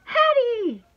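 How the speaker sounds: loud, high, delighted calling out; thrilled greeting; excited cry. A narrator's reading. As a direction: squeal the name as an overjoyed dog. Dog greets arriving owner.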